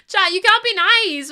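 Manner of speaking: high, loud laughing protest. Real, not acted. Streamer laughing, begging chat not to be nice. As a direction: laugh while scolding chat. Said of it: "You will actually make me sob".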